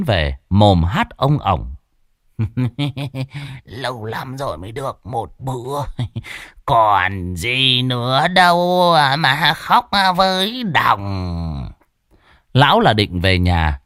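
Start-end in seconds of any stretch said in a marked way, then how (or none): 2.4-3.6 put-on a merry drunk's soft chuckle; soft tipsy laugh. Đồng comes home merry. 3.6-11.7 put-on merry drunk. Drunk Đồng sings his way home.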